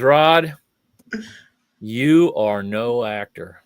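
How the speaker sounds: lightly voiced stern director, slow on the last words; blunt old director. Old director telling fire eater he's no actor, slow and stern. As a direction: drop the verdict slowly, like a stern old director.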